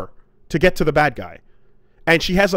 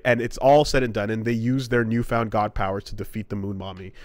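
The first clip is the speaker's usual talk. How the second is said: normal talking voice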